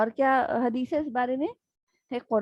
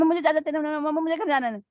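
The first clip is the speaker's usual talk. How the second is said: coaxing plea; 4 semitones higher, faster than usual. Retold or imitated. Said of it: "فل آن مکھن بازی چل رہی ہوتی ہے".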